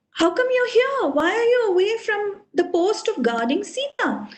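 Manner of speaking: high-pitched, shocked questioning; shocked scolding. Acted. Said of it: "he's shocked".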